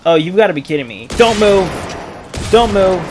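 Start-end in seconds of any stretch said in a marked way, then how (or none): 1.1-3.0 insistent warning, each one separate; urgent command. Real, not acted. Commanding during tense play.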